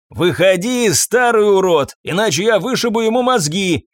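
angry thug's shout; shouted threat. A narrator's reading. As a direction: holler a threat from outside. Thug shouts threat from outside.

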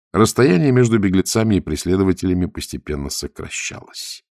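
ordinary delivery, nothing marked

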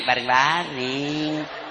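soft, very slow drawn-out reply; sultry wife. Acted. Wife on the phone to husband, slow and sultry. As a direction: stretch it out lazily to tempt. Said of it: "Suara begini yang kasih nafsu".

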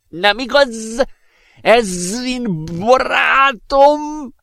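very high, drawn-out jovial professor voice; whooping professor. A narrator's reading. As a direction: hoot it out slowly in a high put-on voice. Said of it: "kurjantott a professzor". (vékony hangon, elnyújtva kurjongató professzor)

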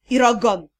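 ordinary delivery

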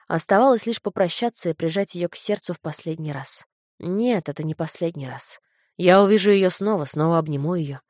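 ordinary delivery, nothing marked

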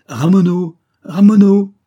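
high, loud drawn-out call; calling the dogs. A narrator's reading. Cardassol calls his lost hound, shouting loudly. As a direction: shout for a dog lost in the woods. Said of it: "le Cardassol appela".

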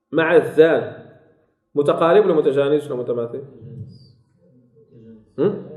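ordinary delivery

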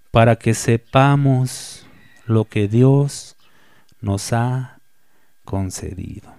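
very slow, weighty delivery; slow emphasis. Real, not acted. Preacher slowing down to press the verse home, deliberately. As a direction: lean on each word.

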